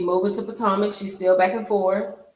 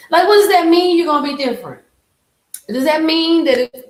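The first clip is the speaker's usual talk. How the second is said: loud, high-pitched disbelief; exasperated outburst; 9 semitones higher, 9 dB louder than usual. Real, not acted. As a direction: demand an explanation, voice raised way up. Outburst mocking the bad acting.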